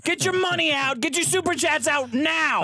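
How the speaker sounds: high, hectoring demand; hammed-up exasperated hustle for money; mock angry rant.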